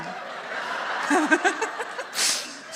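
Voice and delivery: long real laugh, rising high; helpless giggling at own joke; laughter. Real, not acted. Laughs again with audience.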